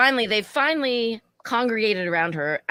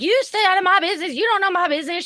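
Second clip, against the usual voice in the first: shrill, fast, much higher put-on voice; mocking high drunk voice; 12 semitones higher, 5 dB louder, faster than usual. Server mocking drunk customer, shrill and fast. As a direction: mimic her, high and fast.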